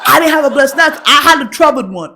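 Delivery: loud, high, laughing indignation; cracking up while complaining loudly; laughing protest. Real, not acted. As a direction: protest through big laughs. Laughing protest at informant's free blessing.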